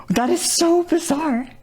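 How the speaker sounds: very high, drawn-out disbelief; slow, high-pitched amazement; astonished. Real, not acted. Streamer stunned by their old voice clip, astonished.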